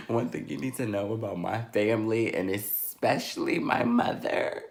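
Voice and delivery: ordinary delivery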